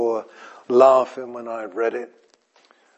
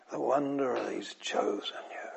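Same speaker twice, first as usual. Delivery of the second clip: "the wonder that he's chosen you" whispered with "wonder" leaned on; hushed wonder; 14 dB quieter than usual. Acted. Preacher marvels before the congregation that God has chosen them, whispered. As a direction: drop to an awed whisper.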